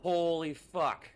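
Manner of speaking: drawn-out exclaiming. Real, not acted. After scare, slow exclaiming.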